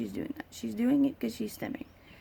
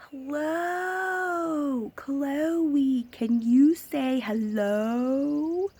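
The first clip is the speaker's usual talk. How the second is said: higher, louder, very slow drawn-out sing-song; mocking a stranger; 5 semitones higher, 5 dB louder, much slower than usual. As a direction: parody someone talking at a child like she's deaf. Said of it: "get in her face and say".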